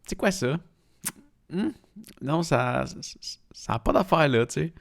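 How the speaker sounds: high-pitched puzzled double take; puzzled reaction. Retold or imitated. Acts out reaction to odd aftertaste.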